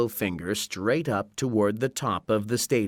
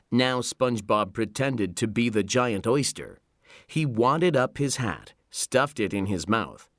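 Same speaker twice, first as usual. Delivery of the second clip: usual speaking voice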